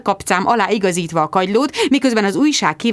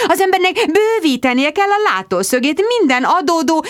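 gushing mother; 5 semitones higher than usual. Acted. Mother sweeping into the café, gushing and high. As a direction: sing it out like a theatrical mother. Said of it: "mother voice".

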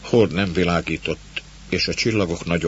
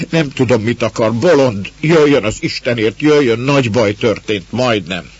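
loud, high, frantic outburst; agitated, raised-voice begging; distraught pleading; 5 semitones higher, 8 dB louder than usual. A narrator's reading. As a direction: cry out desperately for help. Distraught girl begs for urgent help.

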